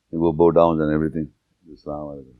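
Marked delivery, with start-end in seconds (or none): 1.7-2.3 soft, murmured prayer ending; a soft, lightly voiced imam's greeting; closing prayer greeting. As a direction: quietly close the prayer. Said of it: "let's do prayer".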